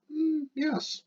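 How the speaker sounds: very high, soft playful yes; squeaky mock reply; mock answer. Shown as a demonstration. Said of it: "yes or no question".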